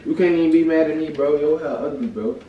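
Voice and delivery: loud, high-pitched taunting; heated argument. Real, not acted.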